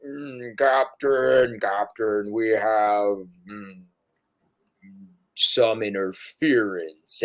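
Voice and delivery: low, slow, drawn-out captain report; pilot NPC warning. Acted. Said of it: "you hear Trunks say".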